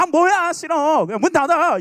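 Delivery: loud, high, whiny snap at mom; sulky teenager slamming back; loud irritated teen whine; teen irritation. Retold or imitated. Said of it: "그런 식으로 짜증 안 내잖아".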